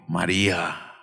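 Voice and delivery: low, hushed, tender naming; tender call. Acted. Jesus calls out to weeping María, low and tender. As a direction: say her name softly like a loving master.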